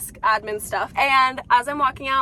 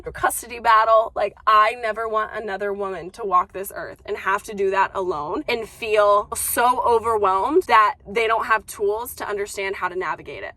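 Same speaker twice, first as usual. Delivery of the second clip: ordinary talk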